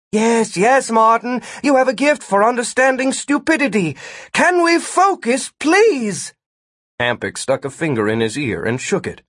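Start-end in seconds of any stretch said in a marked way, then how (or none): 0.1-6.3 high, impatient scolding; fed-up raised voice; exasperated. A narrator's reading. Gwen cuts quarrel short.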